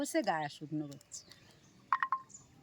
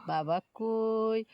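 coaxing the bird; 3 dB louder, slower than usual. Real, not acted. Coaxing the pet bird to talk.

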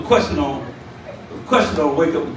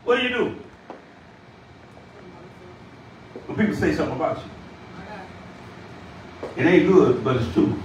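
subdued repeat; 7 dB quieter than usual. Real, not acted. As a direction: drop the pitch and let each phrase sink in. Same question repeated low, with pauses.

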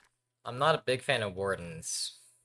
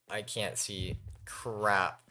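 usual speaking voice

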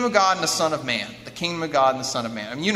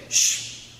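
long shushing sound; hushing shh. Retold or imitated. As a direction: shush someone urgently. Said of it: "What does Jesus say?".

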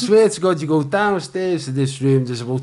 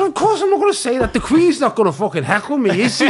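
fast, much higher, laughing it off; amused retort; 11 semitones higher, faster than usual. Retold or imitated. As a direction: brush off the producer's worry with a laugh.

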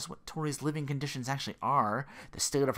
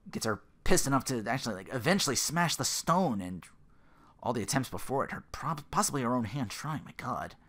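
normal talking voice

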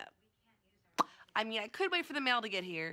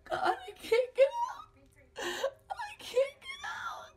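mock wail; slower than usual. Locked in room, mock despair.

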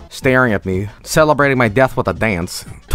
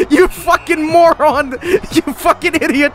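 mock furious yelling; 13 semitones higher than usual. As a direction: yell insults like an enraged footballer. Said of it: "they're like".

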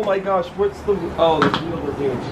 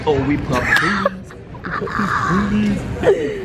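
mocking imitation; 5 semitones higher, slower than usual. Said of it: "As you just said".

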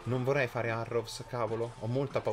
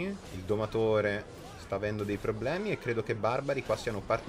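normal talking voice

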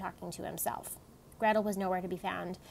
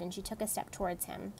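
ordinary delivery

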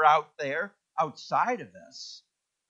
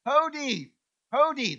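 hodi, hodi, hodi called high and louder; calling out at the door; 8 semitones higher, 3 dB louder, slower than usual. Retold or imitated. Visitor calling to the hut from outside, high and loud. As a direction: call hodi out from twenty feet away. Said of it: "start yelling".

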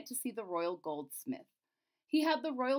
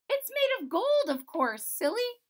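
very high, loud little princess; cartoon princess; 12 semitones higher, 7 dB louder than usual. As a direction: tease him like a know-it-all child.

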